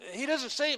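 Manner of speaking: doesn't punched high and loud; emphatic. Real, not acted. Preacher insisting what Jesus never said, loud stress on "doesn't". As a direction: hit "doesn't" hard and high.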